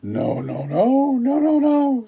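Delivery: high, shouted no no no; loud squeaky refusal. Acted. Wolves refuse again.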